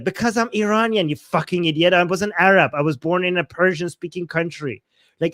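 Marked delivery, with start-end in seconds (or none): none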